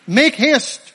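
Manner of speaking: loud, high, urgent command; urgent call. Acted. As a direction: shout it to hurry someone along. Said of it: "It's an urgent call".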